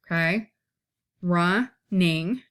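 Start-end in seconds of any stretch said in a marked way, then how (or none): 1.2-2.4 RUNNING as two separate beats, RUN, NING; split into syllables. Shown as a demonstration. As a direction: break Running in two with a short pause. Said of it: "two syllables".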